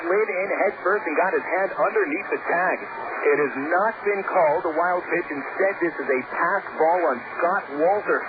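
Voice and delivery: ordinary delivery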